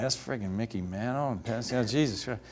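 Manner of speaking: put-on young pitcher gaping at legends, quietly awestruck; awed inner mutter. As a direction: hush it like you can't believe who's there.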